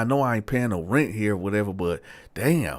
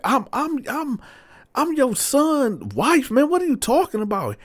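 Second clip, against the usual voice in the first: very high, stammering protest; outraged disbelief. Retold or imitated. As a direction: act out an appalled daughter-in-law, voice way up. Woman acted, outraged at proposition.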